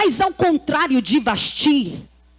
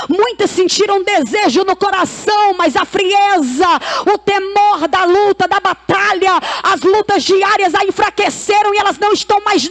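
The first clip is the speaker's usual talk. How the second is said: full-force preaching; 5 semitones higher, 7 dB louder than usual. Real, not acted. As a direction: proclaim God's choice to the congregation, building to a shout. (pregação inflamada, em tom alto e gritado)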